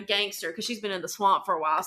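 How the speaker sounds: ordinary talk, nothing marked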